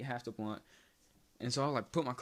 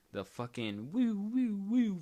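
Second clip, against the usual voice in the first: rising woo woo siren imitation, higher and louder; mock siren; 9 semitones higher, 4 dB louder, slower than usual. Telling friends about the cops, mock siren wail. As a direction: imitate the police siren wailing.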